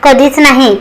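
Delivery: usual speaking voice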